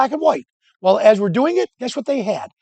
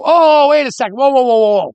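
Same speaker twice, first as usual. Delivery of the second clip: raised-voice mock alarm; calling out to stop; 3 semitones higher, 5 dB louder than usual. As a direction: call after them to halt.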